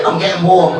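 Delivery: usual speaking voice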